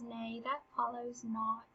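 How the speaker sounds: soft and slow, knowing; quiet retort. Acted. Hamlet to Polonius, correcting him: soft, slow and knowing. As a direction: say it softly and slowly, as if to himself. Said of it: "Because Hamlet knows the story of Jephthah".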